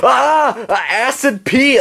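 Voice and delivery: high, drawn-out yelp of acid pee; high mock scream. Puppet yelling acid pee at Cartman, high mock scream. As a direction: shriek it out as the attack lands.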